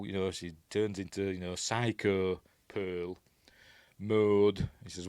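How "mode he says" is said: ordinary talk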